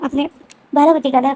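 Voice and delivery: usual speaking voice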